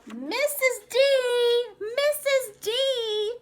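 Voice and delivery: high, loud call to the teacher, stretched; child calling out. Acted. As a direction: yell for the teacher across the playground. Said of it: "shouted Tracy".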